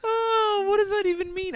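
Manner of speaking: very high, laughing oh; laughing disbelief. Real, not acted. Comedian laughing in disbelief at the word muptard. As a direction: squeal the question through laughter.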